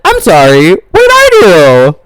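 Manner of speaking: loud, high protest, stretched at the end; mock-indignant shout. Blamed sibling protesting to dad, mock outrage. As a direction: act out wounded innocence at full voice.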